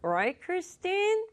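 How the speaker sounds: high-pitched, dodging excuse; evasive. Acted. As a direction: deflect lightly, then appeal for backup. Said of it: "말을 막 돌립니다". (높은 목소리로 얼버무리며 말 돌리기)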